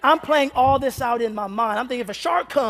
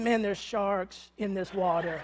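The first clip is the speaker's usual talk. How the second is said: softer, hushed worry to himself; fearful inner thought; 5 semitones lower, 11 dB quieter than usual. Retold or imitated. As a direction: mutter the dread under your breath. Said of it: "I'm thinking".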